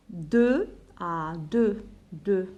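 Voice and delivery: slow, drawn-out counting call; counting with the class. Shown as a demonstration. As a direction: draw out "deux à deux" for them to follow. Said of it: "Tu comptes avec moi". (عدّ مع التلاميذ ببطء)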